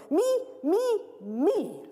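high squeaky me me; spoilt girl. Acted. Thin girl to dog, high squeal. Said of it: "says the thin girl".

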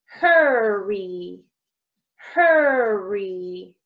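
"Hurry" with a long, breathy h and stretched vowel; teacher sounding out "Hurry", drawn out; breathy H demo. Shown as a demonstration. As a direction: stretch out "Hurry" slowly, starting on a puff of air. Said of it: "Hurry has our hot sound".

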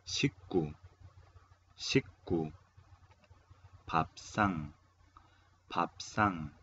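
"식구", "밥상" each said alone with pauses between; teacher modelling "식구" and "밥상" for repeating; model pronunciation. Shown as a demonstration. (pronunciar "식구" y "밥상" como modelo, con pausas)

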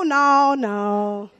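ordinary talk, nothing marked

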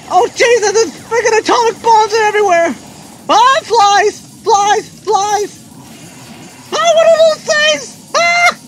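freaking out, high and loud; frantic, high-pitched alarm; real panic. Real, not acted. Player swamped by bombs and flies, panicking. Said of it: "I'm panicking right now".

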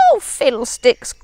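a touch of squeaky mouse; squeaked. Acted. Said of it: "squeaked Town Mouse".

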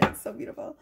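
whispered in real awe; a soft, real whisper of wonder; awed whisper. Real, not acted. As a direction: let the words fall out in a hushed, moved voice. Moved by the reading.

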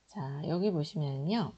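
usual speaking voice

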